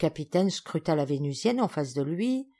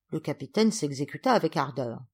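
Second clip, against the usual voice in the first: usual speaking voice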